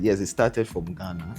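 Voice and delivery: ordinary delivery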